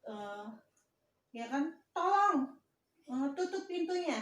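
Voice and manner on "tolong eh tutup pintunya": teacher showing how an order sounds, higher pitch on "Tolong"; raised command intonation. Shown as a demonstration. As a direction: demo a polite command with the pitch pushed up on "Tolong". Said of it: "meninggi kalau kalimat perintah".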